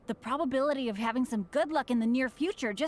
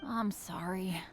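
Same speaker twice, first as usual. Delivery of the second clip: whispered apology; 5 semitones lower, 5 dB quieter, slower than usual. Acted.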